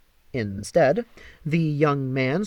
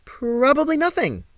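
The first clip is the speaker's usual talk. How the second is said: higher, bright put-on cheer hiding worry; forced cheer; 10 semitones higher than usual. Apollo reassuring Meg, forced cheerful brush-off. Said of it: "I forced a cheerful tone".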